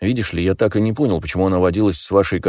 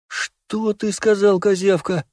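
hissing; 13 semitones higher, slower than usual. A narrator's reading. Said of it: "прошипел Поли".

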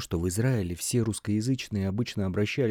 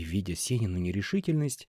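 ordinary delivery

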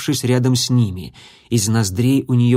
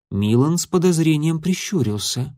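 usual speaking voice

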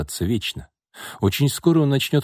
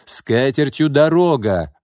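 loud, high, drawn-out angry retort; yelling back; 6 semitones higher, 5 dB louder than usual. A narrator's reading. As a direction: yell a scornful send-off. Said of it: "вопил в ответ Левин". (вопить в ответ)

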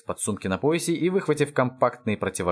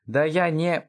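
higher, chewing through the words; munching; 6 semitones higher than usual. A narrator's reading. Grandpa to grandson while eating, munching. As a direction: talk with a mouth full of pizza. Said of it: "прочавкал дед".

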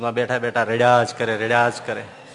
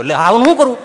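exasperated shrug; 10 semitones higher, 11 dB louder, faster than usual. Acted. Preacher's mock helpless reply.

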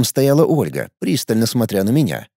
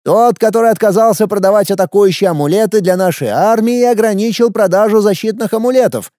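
put-on higher, boyish young emperor; teenage boy voice; 7 semitones higher, 3 dB louder than usual. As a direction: play an arrogant teenage ruler. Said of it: "спросил подростковый голос". (голос подростка-императора)

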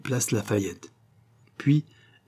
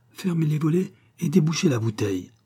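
usual speaking voice

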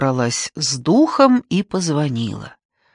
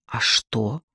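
cautious low query, pulled back; wary; 5 semitones lower, 4 dB quieter than usual. Acted. Mother to daughter, wary low question. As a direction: ask warily, on guard. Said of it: "насторожилась я".

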